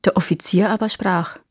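usual speaking voice

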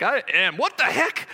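half-joking alarm at his own pride; mock startled self-catch. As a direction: catch yourself mid-boast. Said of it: "And I'm like".

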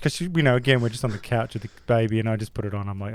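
ordinary delivery

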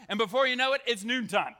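ordinary delivery

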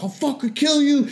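imitating a coworker's furious threat; half-hearted high mock threat; retold threat. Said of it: "swearing at each other".

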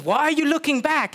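high cow voice. Acted. Cow voiced in bible story.

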